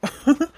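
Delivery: short high scornful laugh; mocking laugh. Drunk father-in-law sneering at the neighbour, mocking laugh. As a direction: laugh in her face, high and loud.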